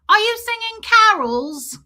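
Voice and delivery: put-on high, loud, drawn-out child's question; little girl voice. Little girl at the door asking, high child voice. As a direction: pipe up like an eager little kid.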